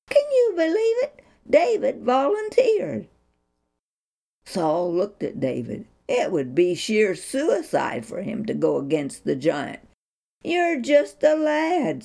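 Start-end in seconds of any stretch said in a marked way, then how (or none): 0.1-3.1 much higher, fast, louder wonder; amazed narrator. A narrator's reading. Storyteller amazed to the listeners, excited and high. 10.4-12.0 higher, slow, a hint of an old king; Saul warning. A narrator's reading. King Saul warning young David, mild worry. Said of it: "Saul warned".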